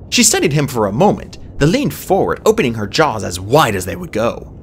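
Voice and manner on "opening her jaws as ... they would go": ordinary delivery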